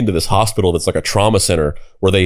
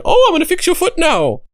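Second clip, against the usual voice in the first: put-on very high, excitable Swiss German accent; Swiss German surgeon accent. Surgeon hopping around the room, imitated Swiss German accent. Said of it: "that kinda interesting Swiss German accent".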